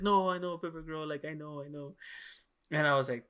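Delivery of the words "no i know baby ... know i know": fading comfort. Retold or imitated. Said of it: "I'm like".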